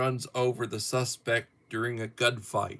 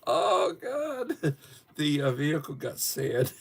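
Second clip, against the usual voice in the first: still laughing; 8 semitones higher than usual. Real, not acted. Still laughing after tears.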